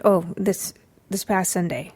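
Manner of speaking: ordinary talk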